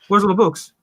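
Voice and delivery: high-pitched startled question; mock alarm. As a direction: imitate a shopkeeper's comic dismay.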